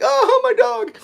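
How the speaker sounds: high, whiny lament; playacted high-pitched distress; mock upset wail. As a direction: wail over a lost dog, hammed-up. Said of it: "you get upset when your dog's gone".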